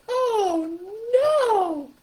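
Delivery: very high, loud puppet voice; loud, high, hammed-up toy animal; Stuffy. Shown as a demonstration. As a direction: wail it as a dismayed puppet, stretching the words. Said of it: "here comes Stuffy or the puppet".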